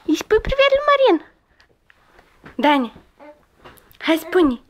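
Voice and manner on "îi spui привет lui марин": eager coaxing. Real, not acted. Parent asking a child to say hello to Marin, high excited coaxing.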